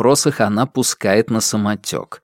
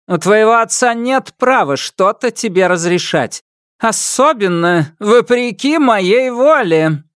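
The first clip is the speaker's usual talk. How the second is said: scornful empress; 10 semitones higher than usual. A narrator's reading. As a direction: scoff at him contemptuously. Empress asserts authority.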